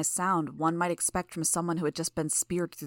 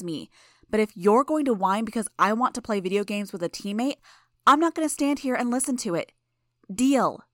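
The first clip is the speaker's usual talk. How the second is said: loud, high angry tirade; furious rant; 5 semitones higher, 5 dB louder than usual. Acted. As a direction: tear into him, no pause. Imp's furious rant at Gru.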